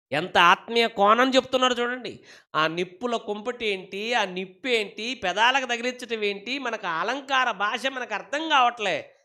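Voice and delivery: high-pitched, urgent preacher's questioning; impassioned preaching. Real, not acted.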